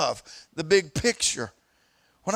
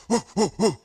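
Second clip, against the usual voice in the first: bear huffing; faster than usual. Retold or imitated. Preacher imitating a mother bear warning off. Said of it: "the bear turned around and go".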